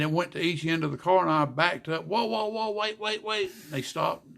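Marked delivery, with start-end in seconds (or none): 2.1-3.5 high urgent call to halt; calling out to stop. Retold or imitated. Calling to the inspectors to stop, urgent and high. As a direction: stop them before they check, high and urgent. Stopping the inspectors mid-check.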